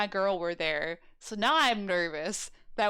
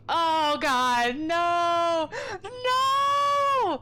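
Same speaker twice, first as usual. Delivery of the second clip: loud rising cry of horror; much louder, higher hammed-up despair; anguished shout; 7 semitones higher, 5 dB louder, much slower than usual. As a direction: scream no as it sinks in. Dramatic horror at reveal.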